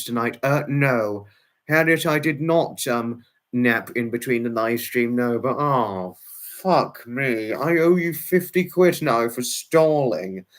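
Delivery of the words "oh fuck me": ordinary delivery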